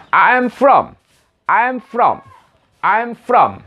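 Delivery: high, measured I AM FROM; model for repetition. Shown as a demonstration. Teacher models the first answer for learners, pitched high and clear. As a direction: lift the phrase clearly, pausing after it.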